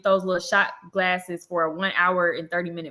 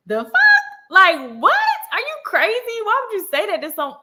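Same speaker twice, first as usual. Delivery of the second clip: outraged; 13 semitones higher, 5 dB louder than usual. Retold or imitated. Outraged reply to strange man.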